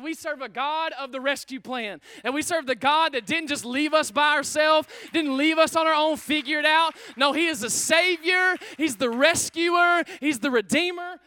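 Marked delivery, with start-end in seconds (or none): 2.2-11.2 loud, ringing praise preaching; shouting the Savior's praises; full-force preaching. Real, not acted. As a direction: lift the room, preach it out loud.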